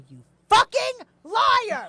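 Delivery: high, loud, drawn-out outburst; re-enacted angry accusation. Woman catching him lying by phone, furious re-enactment. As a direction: explode at the liar, each word stretched.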